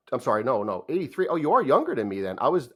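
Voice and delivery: ordinary delivery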